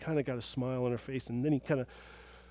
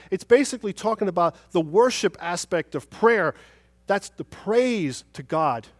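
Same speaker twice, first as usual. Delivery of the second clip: loud, raised-pitch sermon delivery; forceful preaching; 6 semitones higher, 9 dB louder than usual. Real, not acted. Preacher explaining a verse on prayer to the congregation, forceful. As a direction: expound it with full pulpit force.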